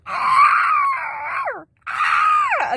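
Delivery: very high, loud mock baby shriek; piercing imitated toddler screech. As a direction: imitate a baby's long squeal. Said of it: "before the baby starts going".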